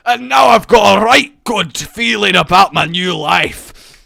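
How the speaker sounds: loud, exaggerated Scottish accent. Joking rerolled peasant speaking in a loud Scottish accent. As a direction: ham up a broad Scottish accent. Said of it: "busted out the, uh, the Scottish".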